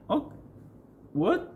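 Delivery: ordinary talk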